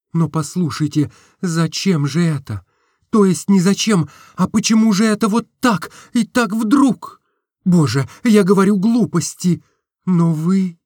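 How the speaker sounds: put-on embarrassed young woman; Nastenka confused. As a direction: stumble through confusion.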